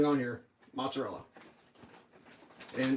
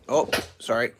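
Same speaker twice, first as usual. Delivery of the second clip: usual speaking voice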